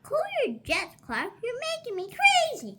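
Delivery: exasperated friend. Acted. Joey scolding Clark.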